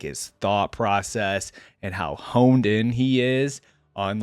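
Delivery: ordinary talk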